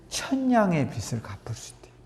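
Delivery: hushed aside, breath only; whisper. Real, not acted. Preacher whispering the proverb's payoff to the congregation. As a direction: lean in and breathe it like a secret.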